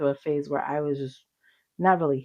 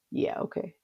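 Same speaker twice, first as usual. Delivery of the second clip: high, soft, unconvinced; reluctant agreement; 10 semitones higher, 5 dB quieter than usual. Retold or imitated. Daughter going home to do the assignment, half-hearted agreement. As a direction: humour them without meaning it.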